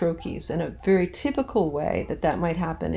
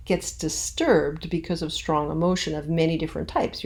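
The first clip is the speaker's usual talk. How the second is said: ordinary talk, nothing marked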